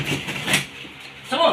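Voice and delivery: slow, breathless whispered order; whispered urging. Real, not acted. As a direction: hiss it slowly under the breath. Healer urging spirit, whispered. (pabulong na utos)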